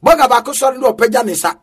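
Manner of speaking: ordinary talk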